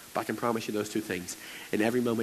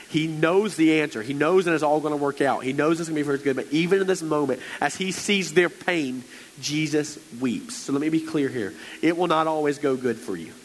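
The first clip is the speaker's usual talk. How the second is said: preaching, building; 5 semitones higher, 4 dB louder than usual. Acted.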